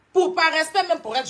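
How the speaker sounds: heated loud outburst in a live clash. Real, not acted. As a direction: push it out loud to make the point.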